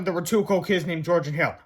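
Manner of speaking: ordinary delivery, nothing marked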